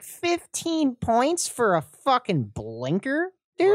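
high, slow mock disbelief; incredulous outrage. Student protesting a blinker deduction, high incredulous drawl. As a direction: stretch it out in disbelief. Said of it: "I was like".

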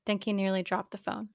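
usual speaking voice

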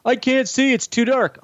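high, mocking whine; whiny complainer. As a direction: parody the fans griping about the dark episode. Mocking complaining Game of Thrones viewers.

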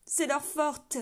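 loud, sobbing reply; sobbing cry. Acted. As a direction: answer through tears. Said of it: "sanglota la voix". (le gardien sanglote sa réponse, désespéré)

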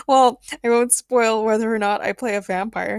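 usual speaking voice

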